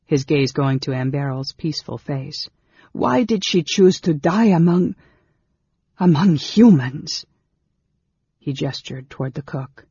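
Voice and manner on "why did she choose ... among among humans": loud, higher, distraught question; anguished cry. A narrator's reading. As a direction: cry out, faltering mid-sentence. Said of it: "Carol cried".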